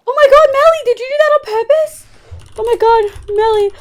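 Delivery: shrieking in fright, high and loud; panicked after a jump scare; scared high shriek. Real, not acted. Goat scare reaction.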